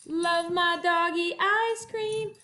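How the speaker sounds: normal talking voice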